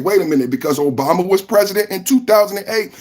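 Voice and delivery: ordinary delivery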